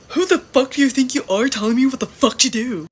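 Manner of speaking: loud, furious mock snarl; hammed-up rage at being told off; angry yell. Girl across the table, furious, yelling at the narrator. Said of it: "She was all like".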